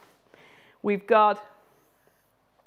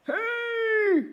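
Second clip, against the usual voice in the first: angry mouse; 13 semitones higher, 3 dB louder than usual. Acted. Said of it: "our little mouse is going".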